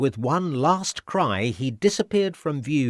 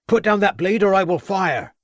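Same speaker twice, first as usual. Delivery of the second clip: much higher and louder, a commanding shout; shouted warning; 9 semitones higher, 6 dB louder than usual. A narrator's reading. Constable warns the villain from behind, shouting. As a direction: bark the order across the rocks. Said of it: "shouted the voice of Crabbe".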